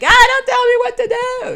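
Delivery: high, loud defiant outburst; yelling angrily. Retold or imitated. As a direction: snap back shrilly at someone giving you orders. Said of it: "yelled at you like there was no tomorrow".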